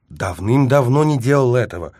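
high, loud, wounded protest; offended objection. A narrator's reading. Narrator denying he has been drinking, offended. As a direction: protest as if unjustly accused. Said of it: "обиженно возразил я".

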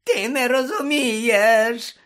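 high, drawn-out whimper; pitiful high-pitched wail; whining Pettigrew. Acted. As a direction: whine it loudly in a squeaky voice. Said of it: "заскиглив Петтігру".

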